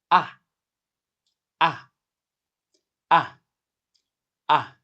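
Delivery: "Ah" falling sharply; short "Ah" dropping like a stern command; fourth tone. Shown as a demonstration. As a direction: drop "Ah" fast from high. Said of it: "The pitch is falling sharply".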